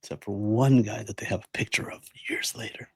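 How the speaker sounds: hushed, voiceless aside; conspiratorial whisper. Pretend. Co-host hints at an Alcatraz escape cover-up, conspiratorial whisper. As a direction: whisper it like a secret theory.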